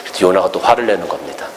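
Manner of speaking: ordinary talk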